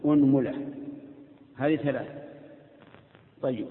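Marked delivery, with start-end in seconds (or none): 0.1-0.7 أنملة starting with u, unmula; أنملة read unmula with damma; damma on the hamza. Shown as a demonstration. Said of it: "ضم الهمزة".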